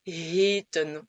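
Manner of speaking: "heten" slowly, first E long like French "é". Shown as a demonstration. Teacher showing learners the right word, long E. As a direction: say "heten" as a drawn-out "hé-ten". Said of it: "Le E en format long se prononce E".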